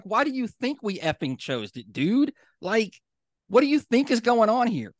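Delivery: high, incredulous mock outburst; fired-up retort. What she wanted to fire back at the neighbour, high and incredulous. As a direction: let loose the comeback you bit back. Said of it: "I wanted to be like".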